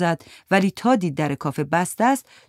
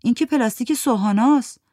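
Giti noticing; 6 semitones higher, 4 dB louder than usual. Acted. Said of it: "گیتی گفت".

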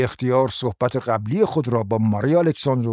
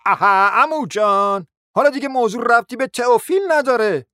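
impatient objection; 10 semitones higher, 3 dB louder than usual. Acted. Nephew interrupts rambling uncle.

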